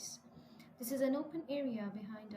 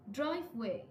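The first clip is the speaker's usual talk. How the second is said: "drive" punched louder in "driveway"; stress on first word; 5 dB louder than usual. Shown as a demonstration. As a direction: hit "drive", let "way" fall. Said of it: "we stress the first word".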